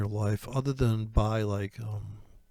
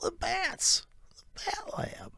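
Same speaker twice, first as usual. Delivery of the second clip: high, softer secretive whisper; conspiratorial whisper; 6 dB quieter, slower than usual. Acted. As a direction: hiss the secret. Conspiratorial aside.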